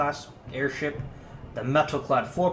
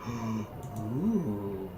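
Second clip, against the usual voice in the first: ordinary talk, nothing marked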